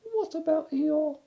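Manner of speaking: very high, small squeaky voice; tiny piglet talking up to his friend; Piglet's squeak. Acted. Piglet correcting Pooh, tiny squeaky voice.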